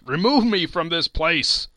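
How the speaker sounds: loud, strained plea; begging to be taken away, voice cracking; broken voice. A narrator's reading. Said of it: "in a broken voice".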